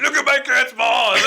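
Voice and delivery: high-pitched boast, calling out; proud daddy. Retold or imitated. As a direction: show it off like a proud dad. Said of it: "like, a proud daddy".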